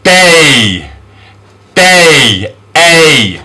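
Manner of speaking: long gliding A in Day; A-sound demonstration. Shown as a demonstration. Teacher models the A sound, Day drawn out. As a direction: stretch Day, then A alone. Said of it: "this is the A sound".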